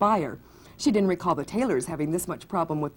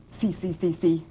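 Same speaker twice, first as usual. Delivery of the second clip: speech difficulty. Acted. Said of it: "she cannot pronounce Countess".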